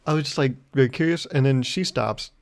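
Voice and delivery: ordinary delivery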